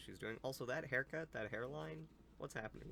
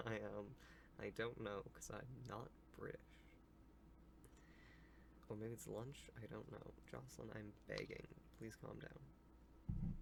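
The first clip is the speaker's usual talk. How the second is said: ordinary delivery, nothing marked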